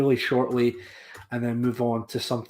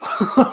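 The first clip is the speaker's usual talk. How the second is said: short high laugh; a real, sudden laugh; burst of laughter; 11 semitones higher, 6 dB louder than usual. Real, not acted. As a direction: laugh out loud at the idea. Laugh alone on line.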